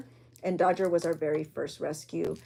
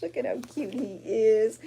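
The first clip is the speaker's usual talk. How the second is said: ordinary talk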